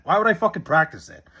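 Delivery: usual speaking voice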